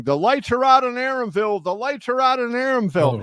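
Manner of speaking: gloating scream. Retold or imitated. Don King after Trinidad wins, gloating mock scream. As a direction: crow it like a victory chant, hammed up. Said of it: "and he's screaming".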